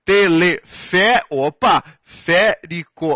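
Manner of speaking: Telefé- then férico, slow and broken into syllables; slow syllable separation; syllable split. Shown as a demonstration. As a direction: break Teleférico into its parts slowly. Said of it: "Separa em sílabas".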